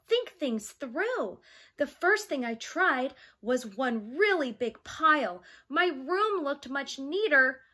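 lively picture-book read-aloud; reading to children. Acted. Teacher reading a storybook to her young class, lively. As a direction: read it like storytime for little kids.

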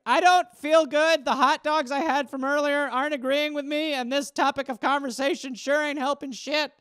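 put-on a boy about to be ill; queasy kid.

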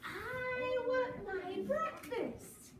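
a tiny bird begging for food; the little chick asks for breakfast, very high. Acted. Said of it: "said the little chick yellow".

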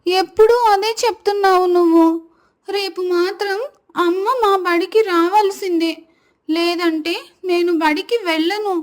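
put-on high-pitched little girl sulking; tearful child's protest. A narrator's reading. Girl refusing school and food to grandmother, high and tearful. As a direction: protest as an upset small girl. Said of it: "ఏడుస్తూ కూర్చుంటుంది".

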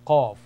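Arabic letter sound demo; deep rounded qaf. Shown as a demonstration. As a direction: hit Qaf hard and rounded from deep in the throat. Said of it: "A rounded sound".

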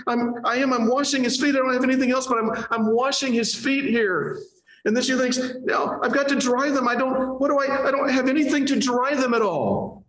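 high, fretful inner voice; a woman scrambling for what to do; anxious woman. Acted.

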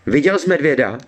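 high, alarmed cry. A narrator's reading. Tearful girl asks her brother about the bear, crying out. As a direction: cry the question out, pitched well up. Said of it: "vykřikla".

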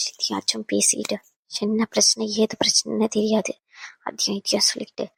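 low, hushed muttering; soft, sulky aside; grumbling under breath. Acted. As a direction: grumble to yourself, barely voiced. Grumbling aside after being hushed.

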